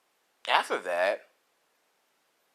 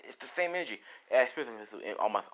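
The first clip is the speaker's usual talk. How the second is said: ordinary delivery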